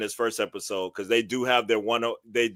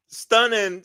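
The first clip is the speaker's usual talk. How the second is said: stunning; 11 semitones higher, 4 dB louder than usual. Real, not acted. Exclaiming praise for the opener.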